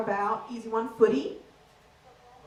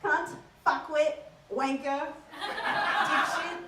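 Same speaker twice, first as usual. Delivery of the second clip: affectionate Aussie insults; 3 semitones higher, slower than usual. Shown as a demonstration. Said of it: "What would I call you then?".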